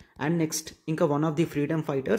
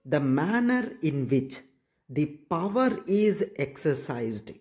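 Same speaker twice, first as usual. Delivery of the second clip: slow dictation; much slower than usual. Shown as a demonstration. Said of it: "teacher dictating the definition".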